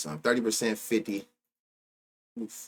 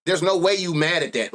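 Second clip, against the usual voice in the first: ordinary talk, nothing marked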